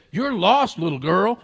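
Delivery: lightly acted big fellow; big man's voice. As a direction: address the little girl as a huge man would. Big man booming at little girl.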